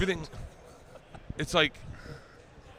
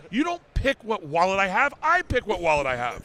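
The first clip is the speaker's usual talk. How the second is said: loud, higher, indignant telling-off of his wife; retold angry rant; 7 semitones higher, 6 dB louder than usual. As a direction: make it a righteous rant about the wallet.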